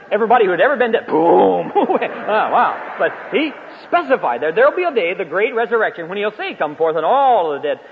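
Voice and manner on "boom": loud, drawn-out explosion noise; imitated boom. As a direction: boom it out like a blast. Imitates all the dead rising.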